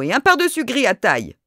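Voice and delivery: high, loud repeated insistence; insist, raising the voice; insistent. A narrator's reading. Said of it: "répéta madame Renaud".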